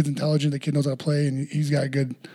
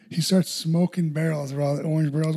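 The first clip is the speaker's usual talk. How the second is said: normal talking voice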